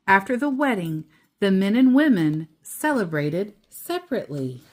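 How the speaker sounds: steady storybook read-aloud; reading to a child. A narrator's reading. Parent reading The Keeping Quilt to child, storybook voice. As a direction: read it gently to your child at bedtime.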